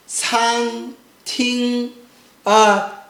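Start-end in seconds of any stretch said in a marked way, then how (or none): 0.1-1.9 SAN TING, slow level syllables; sweet first tone. Shown as a demonstration. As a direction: hold san and ting flat on one pitch. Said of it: "is very sweet".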